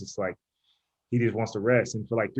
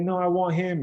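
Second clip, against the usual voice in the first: insistent wife; 6 semitones higher than usual. Retold or imitated. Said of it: "she's like".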